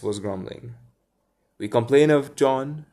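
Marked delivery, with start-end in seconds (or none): 1.6-2.9 louder, higher tattling cry; cried out. Acted. The twins tattling on John to Wendy, loud cry. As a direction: tell on John loudly. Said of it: "cried the twins".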